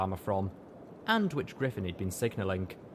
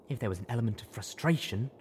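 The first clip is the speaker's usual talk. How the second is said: plain reading voice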